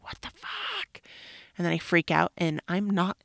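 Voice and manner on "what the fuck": much higher, softer, slow mock disbelief; squeaky retold shock; imitating her own stunned reaction. Retelling her shock at the mess.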